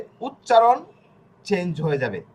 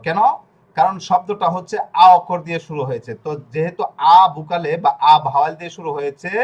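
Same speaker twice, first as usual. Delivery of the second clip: ordinary delivery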